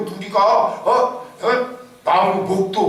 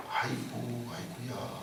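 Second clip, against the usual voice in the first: softer, lower mock groan; mock sigh of lament; 6 semitones lower, 18 dB quieter, slower than usual. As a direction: sigh it out like a weak complainer. (힘없이 흉내 낸 한숨)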